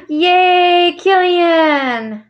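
high, loud, drawn-out hooray; gasp, then cheer the name out long; gleeful whoop of praise; excited cheer. Real, not acted. Cheers for dog chasing its tail.

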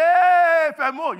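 high, very loud drawn-out hey; mock rowdy shout of welcome; boisterous greeting. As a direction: holler to a pal across the room.

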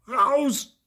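high, loud one-word shout; explosive child's order; angry yell. A narrator's reading. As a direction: yell them out of the room. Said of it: "schreit Theo".

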